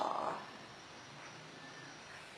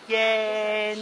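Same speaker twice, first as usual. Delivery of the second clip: ordinary delivery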